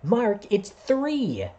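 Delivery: put-on a kid in class correcting Mark slowly. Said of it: "And I remember one kid".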